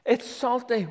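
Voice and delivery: bright announcement. Real, not acted. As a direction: announce it with delight.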